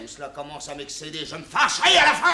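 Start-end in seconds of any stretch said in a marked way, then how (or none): 1.4-2.3 high, loud angry shout; angry outburst. Acted. Captain finally explodes at the peasants, angry shout. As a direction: blow up at them.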